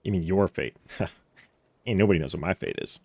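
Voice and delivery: ordinary talk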